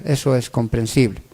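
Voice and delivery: ordinary delivery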